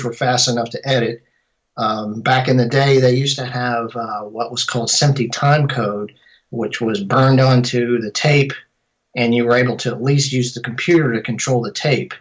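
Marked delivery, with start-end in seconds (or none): none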